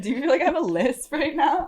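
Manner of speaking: high, giggly question with a lisp; laughing with a lisp. Real, not acted. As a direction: laugh through it, lisping the s sounds. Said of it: "talking weird".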